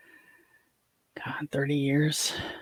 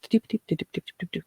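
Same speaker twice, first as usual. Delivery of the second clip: high, quick doop-doop babble; imitated chatter; 4 semitones higher, faster than usual. Mocking the son's girlfriend who keeps talking, imitated chatter. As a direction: mimic someone running her mouth in nonsense syllables. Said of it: "just run her mouth".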